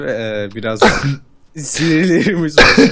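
laughing. Real, not acted. Giggling fit, can't stop.

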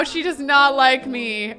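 ordinary talk, nothing marked

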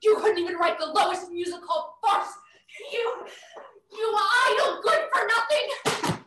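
high, loud furious yelling; mother screaming back at her son in a rage; angry shouting. Acted. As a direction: hurl the insults at him.

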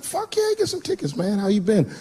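way up high, thrilled to hear from him; excited greeting. Retold or imitated. Comedian greeting his old high school friend, high and excited. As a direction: squeal it like an overjoyed old friend. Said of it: "I was like".

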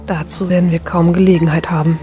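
pained and short of breath. A narrator's reading. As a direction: cut in through pain. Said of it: "in ihrer Stimme war Schmerz zu vernehmen". (schmerzerfüllt, kurzatmig)

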